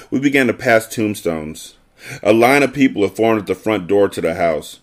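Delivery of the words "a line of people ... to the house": usual speaking voice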